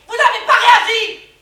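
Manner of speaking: loud, drawn-out outburst; accusing shout. Acted. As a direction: hammer each word out in accusation. Accuses the others of not reacting.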